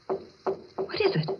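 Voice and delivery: breathless, slow whisper of fear; hushed and afraid, barely voiced; frightened whisper. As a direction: whisper it, scared of what's outside. Claire hears knocking, frightened.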